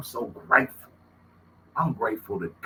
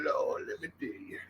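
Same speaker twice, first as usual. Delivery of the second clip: usual speaking voice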